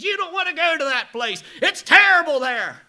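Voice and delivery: high, loud preaching warning; mock sermon warning. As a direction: warn the boys like a fiery preacher. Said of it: "He's all but preaching a sermon".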